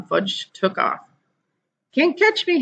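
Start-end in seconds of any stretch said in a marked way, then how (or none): 1.9-2.6 put-on high little boy taunt; high sing-song child taunt; toddler hollering. A narrator's reading. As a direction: tease like a small kid running off. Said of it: "he hollered".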